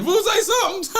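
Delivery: high laughing jab; laughing, high and loud. Real, not acted. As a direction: laugh it out at him. Laughing at producer.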